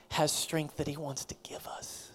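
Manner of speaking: soft hushed whisper. Real, not acted. Preacher whispers God's gift of strength. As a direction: whisper the promise.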